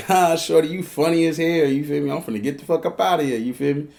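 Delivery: loud, high laughing bluster. Retold or imitated. Man trying to leave her house, laughing it off. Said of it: "So I laugh it off 'cause I'm getting nervous".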